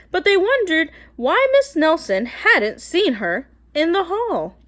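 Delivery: ordinary delivery, nothing marked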